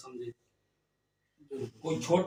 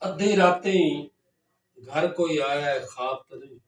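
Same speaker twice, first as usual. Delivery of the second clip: loud, raised mushaira verse delivery; poetry recitation; 7 dB louder than usual. Acted. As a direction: declaim the verse to listeners, repeating it with more force. Recites own verse to audience.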